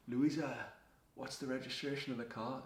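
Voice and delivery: stranger calling out. Retold or imitated. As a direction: mimic the stranger shouting the name. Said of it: "I heard this".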